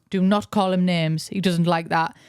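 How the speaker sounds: ordinary talk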